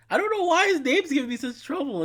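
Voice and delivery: high, loud exasperated whine; baffled, exasperated outburst; frustrated. Real, not acted. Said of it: "It's frustrating".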